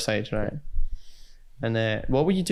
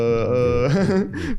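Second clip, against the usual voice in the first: drawn-out French hesitation sound; imitating a French speaker's euh; French filler euh; 3 semitones higher, 3 dB louder than usual. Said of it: "The French are really into that".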